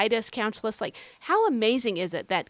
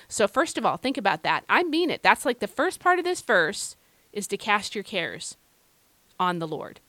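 usual speaking voice

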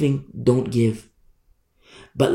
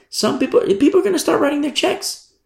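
much higher, sing-song voice; high mocking; 14 semitones higher than usual. Real, not acted. Preacher mocking eager givers, high sing-song. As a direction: pitch it way up, mocking.

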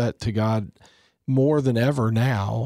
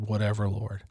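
a soft murmured prayer, much softer; under his breath; 4 semitones lower, 7 dB quieter than usual. Retold or imitated. Deacon hugging a worried father, murmuring under his breath. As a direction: murmur it privately, barely voiced. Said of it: "I could hear under his breath".

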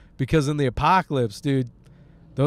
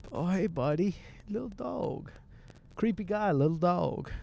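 cooing at a dog; 4 semitones higher, 9 dB quieter than usual. Real, not acted. Talking to a passing dog, high soft cooing. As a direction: coo at the little dog.

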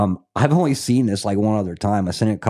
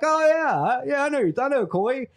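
much higher, laughing mock voice of people in Scotland; imitating people back home. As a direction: play the folks in Scotland who say it the same way, high and amused. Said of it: "everybody's like".

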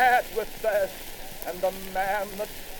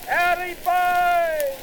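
long, slow hollered call, much higher and louder; shouted call; 9 semitones higher, 7 dB louder, much slower than usual. Acted. Thirsty soldiers calling the water carrier, slow shout. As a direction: bellow it out across the siding. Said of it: "we shouted".

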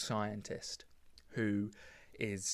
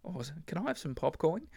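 higher-pitched cheeky ask for a snack; mock casual request; 7 semitones higher, faster than usual. Asking mates at the cinema for popcorn, mock casual. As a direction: put on a high, lilting asking voice. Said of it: "you don't say".

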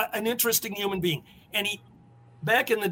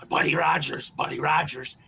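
lower, strutting call of the name; imitating Ricky; 5 semitones lower than usual. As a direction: mimic him calling out Buddy Rogers low. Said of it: "And I can't do his".

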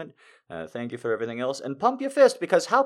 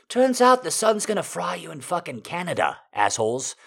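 mock rant; 7 semitones higher, 5 dB louder than usual. Real, not acted. Ranting at the critics.

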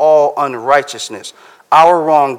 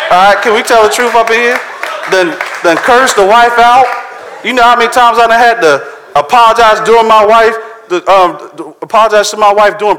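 louder, higher, fast preaching; fired-up preaching; 6 semitones higher, 5 dB louder than usual. Real, not acted. Preacher confessing to the congregation, loud and fired up. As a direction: press the congregation hard.

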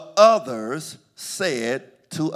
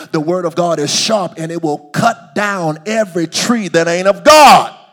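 loud preaching building to a shouted climax; fervent sermon crescendo; full-force preaching; 9 dB louder than usual. Real, not acted.

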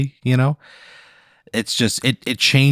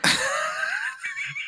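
wild laugh; 10 dB quieter than usual. Real, not acted. Laughing at the quilt.

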